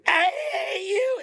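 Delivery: high, crying shriek of pure hate; possessed screaming hatred. Retold or imitated. Programmed person turning on you, shrieking and crying. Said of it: "trying to do an accurate impression".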